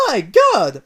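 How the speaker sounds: loud Italian gasp; Mario sees the price, loud shocked Italian; surprised Mario. Acted.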